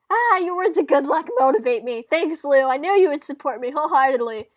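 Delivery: loud, high declamation; over-the-top dramatic thank-you; theatrical gratitude. Acted. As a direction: proclaim thanks grandly at full volume. Sister's mock-grateful declaration.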